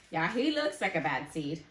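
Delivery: usual speaking voice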